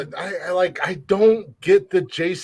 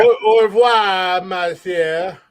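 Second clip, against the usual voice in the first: high, slow fake French; bad French accent; 4 semitones higher, 5 dB louder, much slower than usual. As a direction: ham up a French goodbye. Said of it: "the worst French and Spanish".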